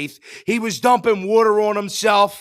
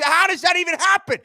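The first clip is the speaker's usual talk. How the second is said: incredulous shout; 12 semitones higher, 5 dB louder, faster than usual. Real, not acted. Fan raging at pitcher.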